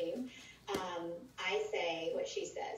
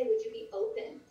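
higher and louder, inviting; model question; 12 semitones higher, 4 dB louder than usual. Shown as a demonstration. Coach showing how recruits will ask everywhere, pitched high. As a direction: lift the question up, bright and open. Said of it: "But if you say".

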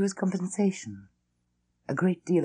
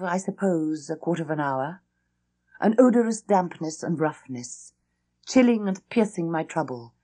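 ordinary delivery

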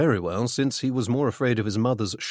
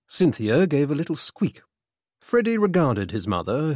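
plain reading voice

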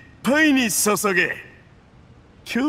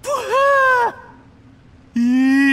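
long drawn-out loud "ぶわー" after a gulp; loud gasp of delight; 5 dB louder, much slower than usual. Acted. Yusupov relishing his wine, a loud satisfied gasp. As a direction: exhale big and loud, savoring the drink.

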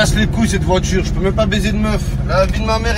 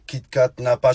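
usual speaking voice

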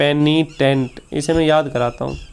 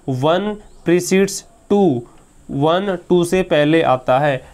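very slow, word by word, for students; slow explaining; much slower than usual. Shown as a demonstration. Teacher slowly explaining precedes to students, very slow. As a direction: spell it out slowly like a patient teacher. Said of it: "मैं इसको आराम से समझाता हूँ".

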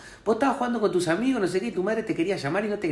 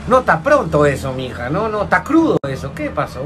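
lower, louder appalled disbelief; horrified reaction; 3 semitones lower, 3 dB louder, slower than usual. Retold or imitated. Friend recoiling at an ugly baby photo, appalled. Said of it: "y vos estás como".

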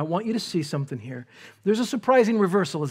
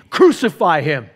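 louder mock mob yell; a touch of the angry mob; mock crowd scream; 9 dB louder than usual. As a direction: shout it like the crowd. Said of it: "gather together and scream".

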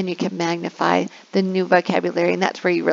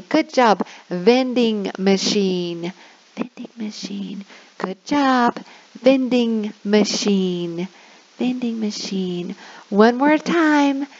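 put-on slow, cheerful word drill for kids; teacher drill; slower than usual. Shown as a demonstration. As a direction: model the word slowly and warmly. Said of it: "I say".

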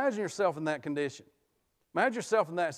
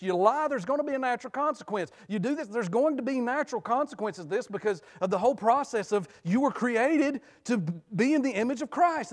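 raised, ringing preacher's cadence; full-force preaching; 7 semitones higher than usual. Acted. Pastor warning the congregation about sin's consequences, preaching hard. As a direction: drive the point home to the pews.

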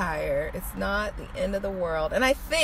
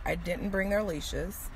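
normal talking voice

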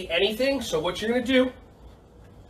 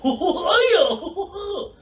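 big laugh; 11 semitones higher, 4 dB louder than usual. Real, not acted. Laughing at own silly song.

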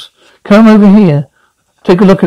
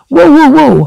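excited shout; 10 semitones higher than usual. Acted. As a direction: yell it from the bleachers. Heckler at ballgame.

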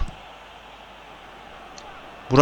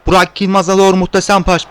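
usual speaking voice

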